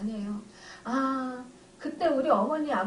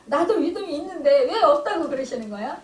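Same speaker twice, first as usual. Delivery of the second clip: mock indignant protest; 3 semitones higher, 6 dB louder than usual. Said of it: "막 이러면서".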